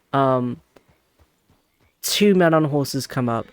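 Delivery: ordinary talk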